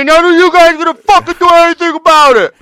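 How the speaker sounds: full-on high-pitched imitated yelling; Jane. Jane daring the guys to stop her, imitated yelling. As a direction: bellow a defiant challenge, hammed-up. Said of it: "loud-ass mouth yelling and shit".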